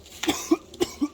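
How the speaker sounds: sudden choking cough; real cough after swallowing a bug; just swallowed a bug. Real, not acted. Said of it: "I swallowed a bug".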